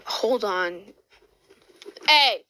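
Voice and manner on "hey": loud, high shout at the dogs; real raised-voice yell; sharp call. Real, not acted. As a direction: call out to scold a dog. Calling out to the dogs.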